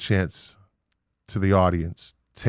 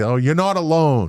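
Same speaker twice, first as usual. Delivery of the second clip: mocking cliché; 7 semitones higher, 4 dB louder than usual. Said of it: "it's such a cliche".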